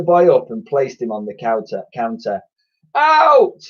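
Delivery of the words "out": very high, very loud bellow. A narrator's reading. Twin bellowing at the slime, very loud. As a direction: roar it at the slime. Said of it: "bellowed Edmund".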